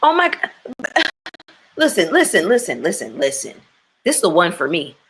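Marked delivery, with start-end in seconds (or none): 0.0-3.5 sudden outburst. Real, not acted. Sudden burst about YouTube.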